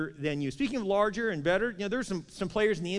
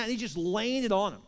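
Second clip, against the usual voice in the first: usual speaking voice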